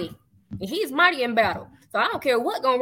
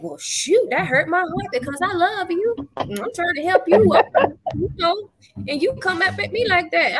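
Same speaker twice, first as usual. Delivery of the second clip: high wounded voice, a bit louder; hurt; 7 semitones higher, 3 dB louder than usual. Retold or imitated. To the person who turned on her, a high hurt complaint. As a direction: plead your hurt, pitched up. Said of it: "I was like".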